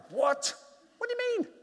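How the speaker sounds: high, stunned "what?"; shocked disbelief. Retold or imitated. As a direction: jump up in pitch, floored by the news. Shocked reply to the friend.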